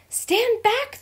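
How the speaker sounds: high, loud warning shout; fearful cry. A narrator's reading. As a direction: yell it in alarm. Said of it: "the witchling cried in fear".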